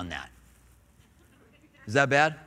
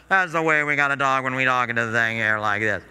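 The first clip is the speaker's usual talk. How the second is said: airline pilot voice; 5 semitones lower than usual. Retold or imitated. Pilot over the intercom to passengers, lower put-on captain voice. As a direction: do the stock captain announcement voice. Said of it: "one pilot somewhere that talked like that".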